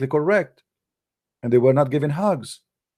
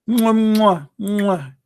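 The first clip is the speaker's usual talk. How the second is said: mock kisses; 4 semitones higher, much slower than usual. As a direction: blow exaggerated kisses to mock the hug-givers. Mock kisses after "give them hugs.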